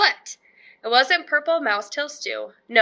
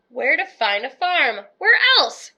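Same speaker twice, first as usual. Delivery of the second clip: ordinary delivery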